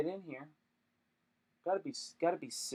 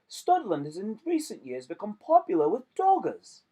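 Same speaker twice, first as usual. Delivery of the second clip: bad British accent; 6 semitones higher, 4 dB louder than usual. Shown as a demonstration. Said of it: "I don't know how to do any accents".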